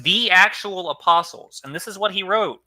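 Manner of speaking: ordinary talk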